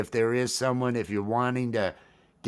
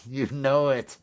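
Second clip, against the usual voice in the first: normal talking voice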